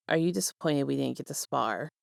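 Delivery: soft, hushed question; gentle murmur in bed; near whisper. Acted. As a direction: ask it close and low, barely voiced. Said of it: "asked in a near whisper".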